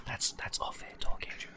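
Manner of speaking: hushed aside, half laughing; whispering. Real, not acted. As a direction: whisper it like a secret. Jokingly hushing a private topic.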